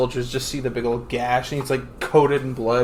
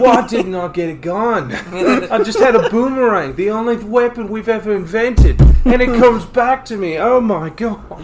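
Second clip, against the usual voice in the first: louder, higher Aussie rant; Australian accent; 6 semitones higher, 6 dB louder than usual. Pretend. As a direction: complain in a broad Australian accent. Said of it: "In an Australian accent".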